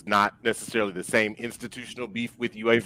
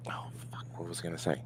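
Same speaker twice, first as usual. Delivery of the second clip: whispered to self; 4 semitones lower, 17 dB quieter, much faster than usual. Real, not acted. Loses train of thought on air.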